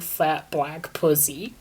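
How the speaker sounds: ordinary delivery, nothing marked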